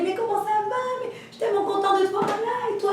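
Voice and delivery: high excited chatter; gushing. Retold or imitated. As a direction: gush over an old friend. Gushing greeting.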